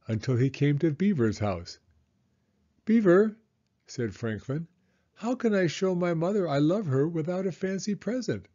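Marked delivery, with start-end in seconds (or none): 2.9-3.4 high, loud call to a friend's door; young turtle calling at the door. Acted. Franklin calls at Beaver's house. 5.2-8.5 a small turtle asking, voiced high; hopeful young turtle asking a friend for advice; young turtle, higher. Franklin's repeated question voice.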